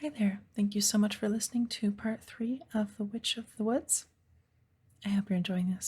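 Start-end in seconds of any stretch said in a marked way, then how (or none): none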